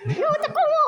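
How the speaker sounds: bossy doll mother. Pretend. Doll mother wakes sleeping kids.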